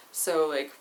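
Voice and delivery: normal talking voice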